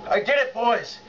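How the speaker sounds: elated triumph. Real, not acted. Celebrating a lift success.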